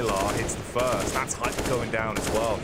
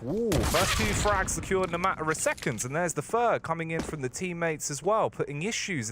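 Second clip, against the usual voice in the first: esports caster; 7 semitones higher than usual. Real, not acted. Calls quick kills.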